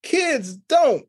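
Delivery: usual speaking voice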